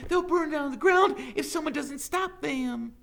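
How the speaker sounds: high, loud, urgent plea; panicked alarm. A narrator's reading. Teacher begging the sheriff for help, panicked. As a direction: beg for help at the top of your voice. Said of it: "gasping for breath".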